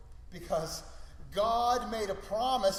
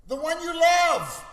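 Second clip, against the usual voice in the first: raised, louder, higher insistence; exasperated God; 8 semitones higher, 9 dB louder, slower than usual. Acted. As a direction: press the point as an impatient God. God voiced, narrowing down the son.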